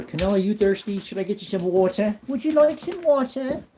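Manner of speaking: high, sing-song dog-owner voice; pet talk. Real, not acted. Offering his dog water.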